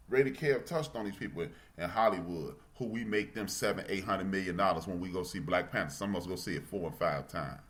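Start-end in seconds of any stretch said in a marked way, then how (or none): none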